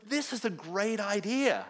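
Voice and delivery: high, loud, delighted verdict; retold enthusiasm. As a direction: reenact someone sold on an idea. Student's enthusiastic idea retold.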